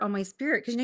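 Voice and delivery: usual speaking voice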